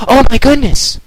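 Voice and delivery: high, loud alarmed cry. A narrator's reading. Twilight seeing the object, high loud alarm. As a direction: gasp it out.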